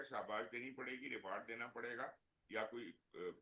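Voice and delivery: faint, faraway mic; barely audible. Real, not acted. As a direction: voice dropping out, very soft. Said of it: "sir, आपकी आवाज बहुत कम आ रही है।".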